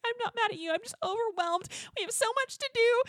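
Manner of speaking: high-pitched mock whine of being overwhelmed; imitated overwhelmed plea. Wife apologizing to her husband, high overwhelmed pleading. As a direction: plead it high, as a retold meltdown.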